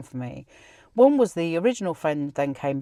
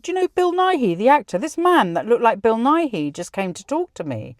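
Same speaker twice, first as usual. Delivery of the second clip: higher and louder, rushing with excitement; eagerly tells the grieving friend about the vision, building excitement; excited telling; 5 semitones higher, 4 dB louder than usual. Retold or imitated. Excitedly recounting the revelation.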